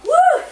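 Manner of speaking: high, loud "woo" of exertion; effort whoop. Real, not acted. Instructor finishing a hard set, whooping from effort. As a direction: let out a sharp, high whoop.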